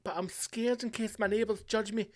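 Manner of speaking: a lightly voiced scared listener; whiny timid listener. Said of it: "if you're sitting there going".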